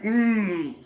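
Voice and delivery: loud, high, drawn-out mock cry; hammed-up exclamation. Host imagines Malcolm X floating in underwear, hammed-up exclamation. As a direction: stretch out the name with comic relish. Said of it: "doing, go like".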